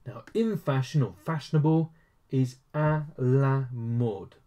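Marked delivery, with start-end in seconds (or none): 2.7-4.4 à la mode stretched out slowly; slow model pronunciation. Shown as a demonstration. Teacher introduces the French for fashionable, slowly. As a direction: draw out each word of à la mode. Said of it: "So, you got à".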